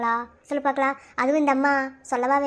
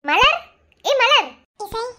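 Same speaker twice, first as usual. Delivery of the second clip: high, loud call of a name; 8 semitones higher, 5 dB louder, much slower than usual. Acted. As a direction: call to get a friend's attention. Calling to a silent friend.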